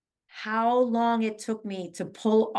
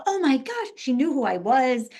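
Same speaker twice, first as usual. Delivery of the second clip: high, thrilled retelling of a fan's reaction; excited gushing; 3 semitones higher, 4 dB louder than usual. Retold or imitated. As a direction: gush like a starstruck fan. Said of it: "she was so excited".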